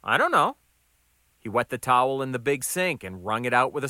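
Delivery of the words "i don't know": high, louder protest; harried new guy on the defensive; flustered waiter. Acted. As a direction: plead ignorance, pitched up. New waiter pleads ignorance.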